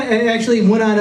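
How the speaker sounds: ordinary delivery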